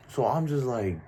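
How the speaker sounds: ordinary delivery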